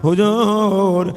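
wailing chanted lament with long-held words; tearful plea. Retold or imitated. Poor Ansari confesses poverty to the Prophet, chanted tearful lament. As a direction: plead melodically, often high, as if weeping. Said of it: "কাঁদতে শুরু করলো".

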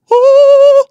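sudden very high, loud scream; shocked scream. Acted. Knuckles screaming as the emerald shatters. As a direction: cry out in shock.

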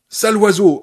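loud choked cry, pitch up; hiccuping cry. Acted. Annie hiccuping insults at Paul. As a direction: spit it out between hiccups. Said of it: "hoqueta-t-elle".

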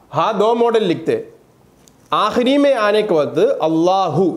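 usual speaking voice

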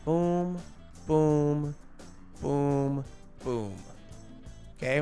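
ordinary delivery

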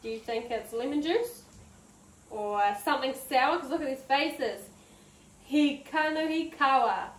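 ordinary talk, nothing marked

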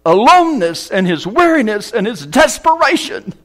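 high, loud, drawn-out preacher's delivery; preaching at full force. Acted. Preacher telling Jacob's story, preaching at full force. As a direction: thunder the story to the congregation.

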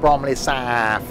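slow Bromley Saff, South drawn out as SAAAF; put-on local pronunciation. Shown as a demonstration. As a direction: stretch the A in Saff, end on F. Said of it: "you gotta pronounce it like that".

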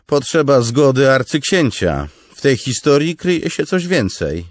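loud, high sceptical lieutenant; shrewd remark. Acted. Noro probing Kaladin's past, loud sceptical remark. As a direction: point out the flaw in his story. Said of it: "zauważył porucznik Noro".

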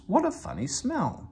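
high, cheeky little engine voice; young engine sniffing the air; Thomas the tank engine. A narrator's reading. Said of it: "remarked Thomas".

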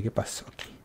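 retelling his own scared words in a whisper; whispered quote. Retold or imitated. Gamer retelling his scare to viewers, whispering. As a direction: breathe the question out without voice.

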